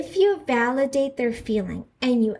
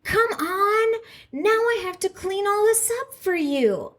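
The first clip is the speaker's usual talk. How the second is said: higher, exasperated complaint at a mess; annoyed spouse; 9 semitones higher than usual. Retold or imitated. Spouse walking in on a spilled cup, exasperated and higher. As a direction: scold like a put-upon spouse stuck cleaning up. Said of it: "they're like".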